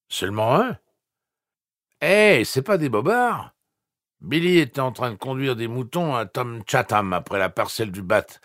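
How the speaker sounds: loud, high tipsy rancher; tipsy man. A narrator's reading. Bob voiced tipsy on phone.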